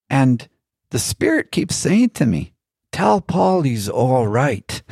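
high Irish lilt, slowing at the end; bad Irish accent. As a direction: put on an old Irishman's accent, not very well. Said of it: "not doing a very good Irish accent".

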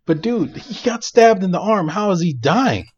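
high, loud disbelief; can't believe this nonsense; rising outraged disbelief; incredulous outburst. Real, not acted. Outraged at movie death.

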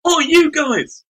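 high, loud mock telling-off; lollipop man's outcry. Lollipop man accusing the boys, high mock shout. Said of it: "the lollipop man went".

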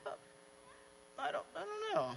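high, very soft, unsure voice; mock timid backing down; timid uncertainty. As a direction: shrink into a small high voice. Unsure test subject backing down.

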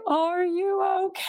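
high, gentle worried check-in; concerned friend. Retold or imitated. Friend at the hospital door asking a new mother, gently concerned. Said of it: "walk through that door and say".

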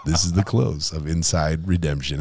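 hammed-up radio announcer voice. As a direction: announce the sign-off like a DJ. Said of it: "Uh, radio voice, maybe?".

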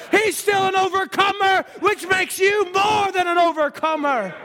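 shouting, rising preacher's proclamation; preaching at full force. Real, not acted. Preacher proclaims God still delivers, shouting. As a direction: proclaim it and drive it home.

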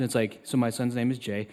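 ordinary delivery

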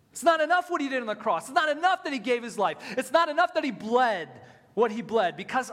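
fast, loud preaching build; rising preacher intensity. Real, not acted. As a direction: hammer the point like a preacher at full force.

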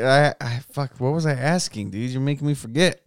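ordinary delivery

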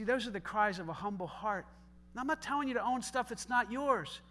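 ordinary talk

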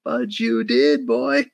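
mock him like you don't buy a word of it; sarcastic mockery. Podcaster mocking a character's claim to cohosts, high sarcastic taunt.

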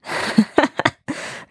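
brothers' breathy mocking laugh. A narrator's reading. Brothers laughing at Weiko, mocking laugh. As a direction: laugh him to scorn. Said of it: "lachten sie".

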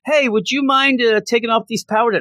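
high, pleading inmate voice; squeaky inmate. Retold or imitated. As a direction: play a desperate little inmate begging.

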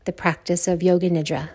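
measured meditation voice with long silences; guided meditation. Acted. Yoga teacher opening a Yoga Nidra practice, guided meditation. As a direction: guide them calmly into stillness, letting phrases sink.

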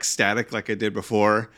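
ordinary talk, nothing marked

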